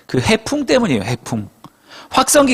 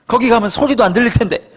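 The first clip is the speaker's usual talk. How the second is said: acted-out annoyed protest, higher voice; mock-irritated objection, pitched up; exasperated inner grumble; 7 semitones higher than usual. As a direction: grumble indignantly as the put-upon fisherman. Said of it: "짜증이 극치였어요".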